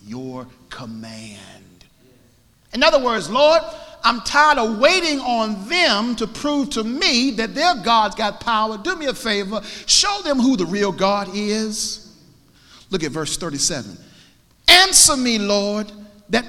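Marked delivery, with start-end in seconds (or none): 0.1-1.8 very slow, low, whispery prayer; hushed reverence. Acted. Solemn end of Elijah's prayer. 2.7-12.1 full-force preaching. Acted.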